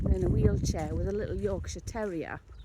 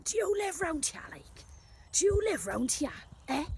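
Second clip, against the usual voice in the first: mock scream; 8 semitones higher than usual. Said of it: "She was screaming".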